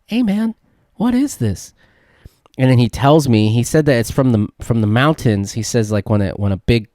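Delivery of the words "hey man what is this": incredulous question. Retold or imitated. Asking about dust storm.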